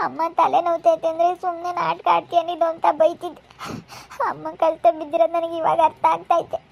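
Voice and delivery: tearful, remorseful confession; acted crying that breaks between phrases. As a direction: confess through sobs, catching your breath. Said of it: "ಯಾಕೆ ಅಳ್ತೀಯ?".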